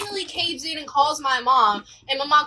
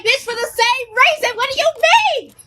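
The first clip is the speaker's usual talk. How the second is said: higher, indignant comeback; exasperated retort; 8 semitones higher, 5 dB louder than usual. Retold or imitated. Student snapping back at the nurse, higher and indignant. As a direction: fire back, fed up.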